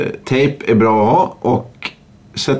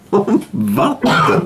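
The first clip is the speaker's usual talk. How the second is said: slow, drawn-out disbelieving question; incredulous echo; 5 semitones higher, much slower than usual. Real, not acted. Cohost echoes the superglue claim in slow disbelief. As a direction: stretch it out as if you can't believe it.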